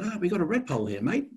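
high-pitched mock Australian; Australian accent. Warden telling a birder about the redpoll, imitated Australian accent. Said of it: "this is an Australian accent".

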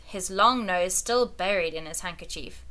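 usual speaking voice

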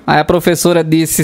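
ordinary talk, nothing marked

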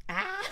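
short, high squeal of nerves; excited squeal. Real, not acted. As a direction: let out a little high squeal. Squeals while waiting to watch.

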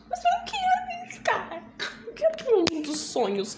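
put-on high whiny kid pleading; mock childish whine about the dream world. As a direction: whine it like a spoiled little kid.

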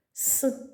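a long voiceless ссс hiss; isolated hissing s. Shown as a demonstration. Teacher isolating the sound с for pupils. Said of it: "Перший звук".

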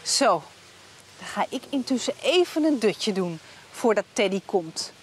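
ordinary talk, nothing marked

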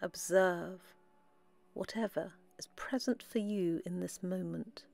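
soft, unhurried meditation voice with long pauses; guided meditation. Acted. Guide leading listeners into relaxed breathing, soft meditation voice. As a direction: guide them gently inward, softly, phrase by phrase.

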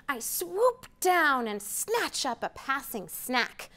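normal talking voice

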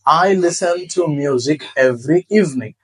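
spoken very slowly for learners; deliberate, slow model sentence; slow, careful example. Shown as a demonstration. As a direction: lay out each word like a teacher. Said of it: "যেমন".

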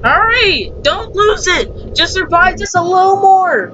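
urgent yelling. Real, not acted. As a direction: yell encouragement to survive. Cheering friend to survive.

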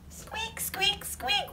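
high, soft little squeaks; mouse squeaks. Acted. Teacher voices the found mouse.

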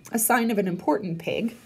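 plain reading voice

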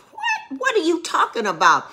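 high disbelieving cry; shocked what; incredulous outburst. Real, not acted. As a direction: react like it's absurd.

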